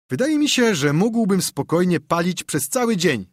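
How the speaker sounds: put-on high-pitched boyish brag; boasting. A narrator's reading. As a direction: brag proudly to your pal. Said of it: "pochwalił się Joe". (chłopięce przechwałki, wyższym głosem)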